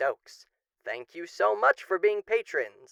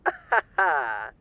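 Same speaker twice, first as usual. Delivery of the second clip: amused chuckle; 3 semitones lower than usual. Acted. Amused at his own irony.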